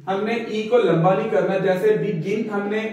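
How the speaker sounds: normal talking voice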